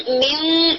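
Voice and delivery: میوں short and thin; the way not to say it; light wrong take. Shown as a demonstration. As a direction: incorrect light demo. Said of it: "یوں نہیں کرنا".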